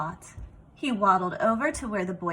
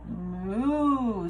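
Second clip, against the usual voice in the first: a lazy imitated moo from the cow; drawn-out mock moo for the kids. Cow answers Duck.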